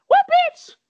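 high, loud outraged cry. Real, not acted. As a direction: shout your disbelief at him. Outraged at the president.

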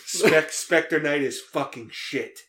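put-on slow, loud New Jersey mobster accent. You have the better accent.

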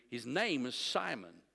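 ordinary talk

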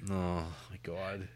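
soft, slow dismayed groan; weary reaction. Retold or imitated. Viewer reacting to a screamy movie, weary and quiet. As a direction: sigh it out, worn down.

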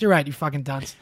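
usual speaking voice

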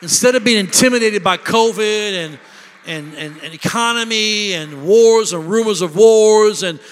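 loud, rising preacher's cry, building to shouts; full-force preaching. Acted. Pastor stirring up the church with a loud, rising sermon cry. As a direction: whip the church up with every line.

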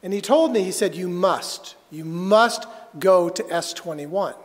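ordinary talk, nothing marked

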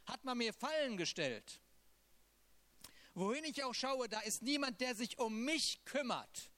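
impassioned reading of a lament; psalm reading. Acted. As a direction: pour the psalm out like a plea. Reads Psalm 142 aloud.

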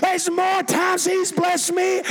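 high, loud shouted praise; preaching at full force. Real, not acted. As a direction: shout it out to rouse the congregation.

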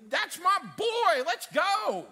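loud, high, slow cheer; root for him like a proud fan; proud church cheering loudly; cheering crowd. Acted.

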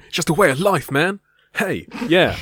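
high, loud, chilled-out dude voice; put-on laid-back dude. Friend shows off his new way of speaking, loud and high. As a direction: play a too-cool surfer type. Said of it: "this way that I'm gonna be speaking now".

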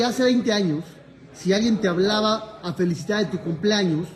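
ordinary talk

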